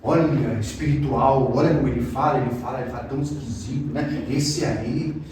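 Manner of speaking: hushed mock reverence; mock awed churchgoer. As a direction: imitate a gullible fan of the preacher. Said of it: "Tem gente que gosta e cai nessa".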